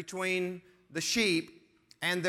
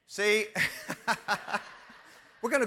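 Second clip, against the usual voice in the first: laughing. Real, not acted. Laughs at own joke.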